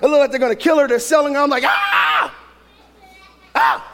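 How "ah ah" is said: long hammed-up scream, then a short yelp; mock horror scream; crazed mock scream. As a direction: scream like a comically overwrought movie watcher. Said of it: "I'm going crazy".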